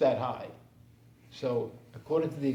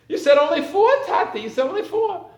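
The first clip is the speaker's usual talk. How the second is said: put-on high, loud childish protest; child reminding; 12 semitones higher, 10 dB louder than usual. Child to Tati at the park, high loud reminding. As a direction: remind Dad of his own rule.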